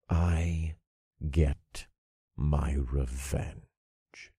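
low, slow smug menace; smirking. Acted. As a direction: savor the revenge slowly. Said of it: "I smirked".

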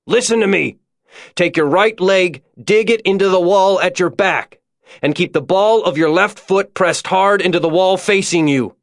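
high, projected instructions from below; urgent calling. A narrator's reading. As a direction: call up firmly to a panicking climber. Father calling urgent instructions up chimney.

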